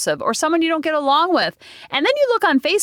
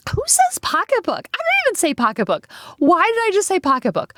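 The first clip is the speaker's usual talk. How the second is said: incredulous self-mockery; 7 semitones higher than usual. Real, not acted. Mocking her own word choice.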